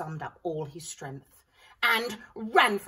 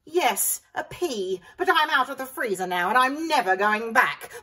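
loud, higher gleeful villain; gloating villain; 5 semitones higher, 8 dB louder than usual. A narrator's reading. Evil pea boasting to the vegetables. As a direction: boast like a cartoon baddie.

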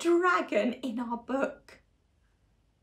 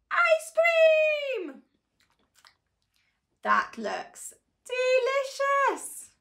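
high, drawn-out delight; 13 semitones higher, slower than usual. Acted. Reader admiring the imagined ice cream, delighted. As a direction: savour the treat with glee.

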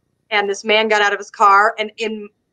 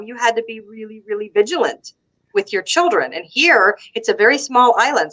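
ordinary talk, nothing marked

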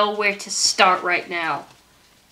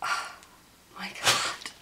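awed hush; 3 semitones lower, 14 dB quieter than usual. Real, not acted. Overwhelmed by the bracelet.

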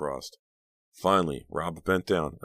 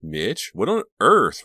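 loud, high, drawn-out exclamation; shocked cry; 6 semitones higher, 10 dB louder, slower than usual. A narrator's reading. Reva sees Mitch in a fight, cries out. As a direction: cry out slowly in disbelief. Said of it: "Reva cried".

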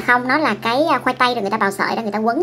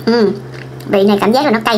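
speaking with food in mouth; chewing while talking; 3 dB louder than usual. Real, not acted. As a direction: mouth partly full of noodles. Seen 0: Noodles eaten just before; lips pursed, mouth seems partly full early on.

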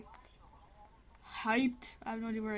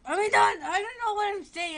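high, loud, flustered rush; real embarrassed excitement; flustered outburst; 9 semitones higher, 10 dB louder than usual. Real, not acted. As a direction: blurt it out in a panic. Flustered after messing up.

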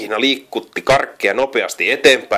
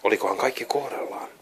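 hushed, suspicious whisper; whispered wondering; 10 dB quieter than usual. A narrator's reading. Narrator wonders if the disguise holds, whispering. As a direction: breathe the question like a secret. Suspicious guard checks the pig.

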